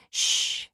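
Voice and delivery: soft unvoiced shh; hushing shh. Woman stops her date at the car door with a hushing shh. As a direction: breathe a soft hush to silence him.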